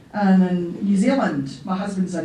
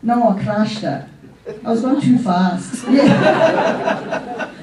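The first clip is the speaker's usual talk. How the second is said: laughing one-sided phone chat, louder and higher; pretend phone call; 3 semitones higher, 5 dB louder than usual. At the memorial, faking a phone call from Alec in heaven, laughing. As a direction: hold a giddy fake call with the dead. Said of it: "there's a phone call here".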